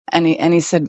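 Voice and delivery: normal talking voice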